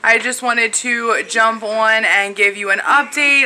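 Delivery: ordinary delivery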